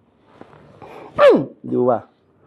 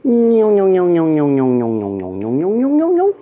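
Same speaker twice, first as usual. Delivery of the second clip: imitated buzzing sound; 3 semitones higher than usual. Imitating a vibration while massaging.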